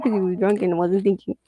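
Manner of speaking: ordinary talk, nothing marked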